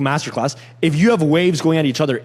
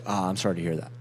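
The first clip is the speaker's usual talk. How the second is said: much lower and softer, falling at the end; sympathetic, falling and hushed; downward inflection; 9 semitones lower, 10 dB quieter than usual. Shown as a demonstration. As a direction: drop low and soft with sympathy. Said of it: "it has to end on a downward inflection".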